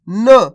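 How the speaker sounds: "न" as a bare n with a brief schwa; half consonant sound. Shown as a demonstration. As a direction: sound out "न" without the long aa. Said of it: "ना का उच्चारण आधा कर रहे हैं". ("न" का आधा उच्चारण)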